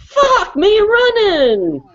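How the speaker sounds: loud exclamation. Real, not acted. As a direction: belt it out slowly in disbelief. Exasperated punchline after a rant.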